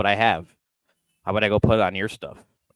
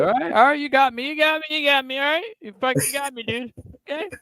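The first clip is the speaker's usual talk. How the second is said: mock surrender voice; 3 dB louder than usual. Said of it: "I said".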